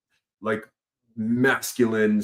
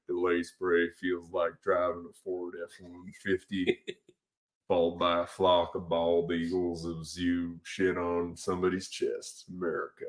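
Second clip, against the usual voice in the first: deep-voiced truck ad; 3 semitones lower, 8 dB quieter than usual. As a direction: parody a rugged American truck ad, deep and unhurried. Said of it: "I also have a sort of a deeper voice".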